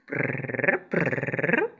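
soft rolled purr; cat purring. Retold or imitated. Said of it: "Los gatitos hacen ruiditos".

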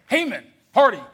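ordinary talk, nothing marked

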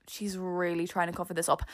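very soft, low aside; judging inner thought. Retold or imitated. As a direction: voice her silent suspicion under your breath. Voicing teacher's silent judging thought.

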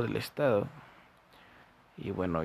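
normal talking voice

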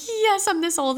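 high-pitched excited glee; happy. Retold or imitated. Delighted by the bounce house at her party, gleefully excited. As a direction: retell it with a gleeful squeal of delight. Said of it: "so happy".

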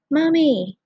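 put-on loud, high child's cry for his mother; child yelling. A narrator's reading. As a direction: yell for Mommy like a scared toddler. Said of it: "the boy yelled between sobs".

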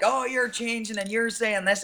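high-pitched imitation of nagging people; mock accusing locals. Guest retells what people back home say, high mock accusation.

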